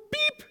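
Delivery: tiny, very high beep; short squeaky chick noise; chick peep. A narrator's reading. Animals scramble into truck.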